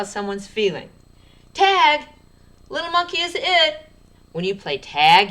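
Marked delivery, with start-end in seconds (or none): 1.5-3.9 high, loud excited shout. Shown as a demonstration. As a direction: call it out like a kid playing tag. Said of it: "you need to sound excited when you read that sentence".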